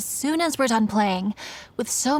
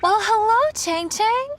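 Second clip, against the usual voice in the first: much higher, louder delighted hello; cheerful greeting; 10 semitones higher, 3 dB louder, slower than usual. Acted. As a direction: greet the little girl with bright delight. Greeting a little girl.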